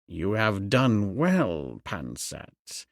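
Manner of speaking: slow, low praise, dropping away. A narrator's reading. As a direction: draw out the approval like a pleased master. High priest praises his servant.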